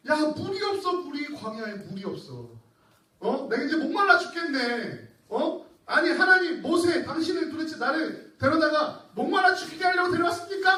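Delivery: high, loud whining gripe; thirsty complaining Israelites. Acted. Preacher voicing thirsty Israelites blaming Moses, loud whine. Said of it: "막 불평하죠".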